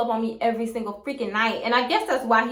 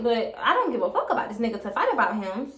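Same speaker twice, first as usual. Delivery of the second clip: normal talking voice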